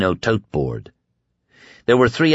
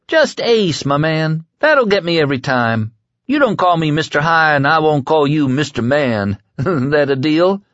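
Ace's voice, higher; 7 semitones higher than usual. Acted. Voices Ace High.

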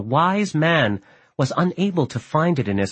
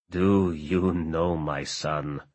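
low, slow, soft, trembling; an old man's voice trembling with hurt; 7 semitones lower, 5 dB quieter, much slower than usual. A narrator's reading. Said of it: "said the Pasha in a trembling voice".

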